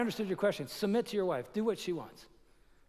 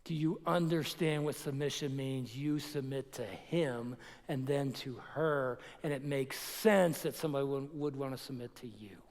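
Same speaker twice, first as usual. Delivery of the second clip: earnest appeal; 6 semitones lower, 5 dB quieter than usual. Retold or imitated. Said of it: "wanna grab that guy and go".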